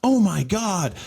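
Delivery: high, bright-pitched mock commercial read; ad announcer parody. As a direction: gush like a cheesy TV ad. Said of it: "starts playing an ad".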